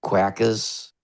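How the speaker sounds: mimicking a Philadelphia accent, word by word; mock Philly accent with dropped R's; imitated Philadelphia accent. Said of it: "my sister and my mom were saying".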